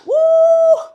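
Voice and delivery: huge high whoop; rock concert cheer. Shown as a demonstration. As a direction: cheer like you're in the crowd at a rock show. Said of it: "au milieu d'un concert de rock". (cri de joie au concert de rock, très aigu et fort)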